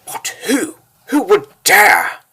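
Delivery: high-pitched, slow outburst on dare; indignant outrage. Acted. Ruler hearing of the attack, outraged. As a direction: rise from question to affronted cry.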